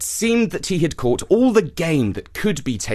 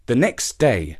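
plain reading voice

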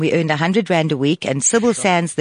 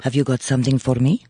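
lower-pitched Russian-accented voice; 5 semitones lower than usual. Shown as a demonstration. [name] asks the hosts for something, Russian accent. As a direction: ask it in a low Russian accent. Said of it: "Do the Russian for us".

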